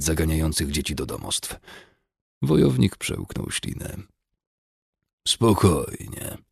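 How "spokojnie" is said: slow, hoarse calming word. A narrator's reading. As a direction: rasp it out, drawn slow. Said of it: "rzucił ochryple".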